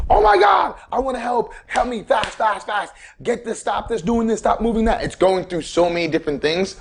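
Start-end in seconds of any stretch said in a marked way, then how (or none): 0.1-5.0 much higher, shouted frantic plea; panicked shout. Retold or imitated. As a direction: yell it like your body is freaking out. Panicked body acted out.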